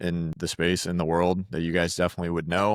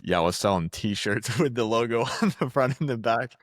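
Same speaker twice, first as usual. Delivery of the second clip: laughing mock quote; 4 semitones higher than usual. Mocking guest's old modest answer.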